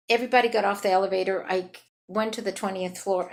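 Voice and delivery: normal talking voice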